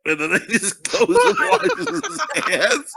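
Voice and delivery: usual speaking voice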